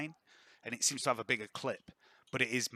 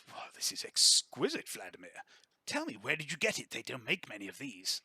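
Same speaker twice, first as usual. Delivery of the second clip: general admiring. Acted. General admiring Vladimir's pistol, amazed. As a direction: marvel at the pistol. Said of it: "looks over your weapon and says".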